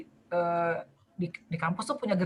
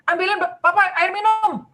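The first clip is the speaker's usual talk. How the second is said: suami menyuruh; 9 semitones higher, 6 dB louder than usual. Retold or imitated. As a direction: bark it out like a demanding dad. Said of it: "suaminya nih bilang gini". (suami yang menyuruh anak dengan suara tinggi dan keras)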